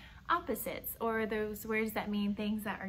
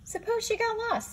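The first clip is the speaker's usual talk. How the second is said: high, fast anxious owlet voice; 11 semitones higher than usual. Acted. Owlet Sarah fretting to her brothers, high and quick. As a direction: squeak it out in a worry. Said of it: "said Sarah".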